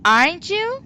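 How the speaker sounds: "Aren't you" swung high and loud; loud, unsure rising "Aren't you"; rising intonation. Shown as a demonstration. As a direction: exaggerate the upward jump on "you". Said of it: "I am raising the intonation".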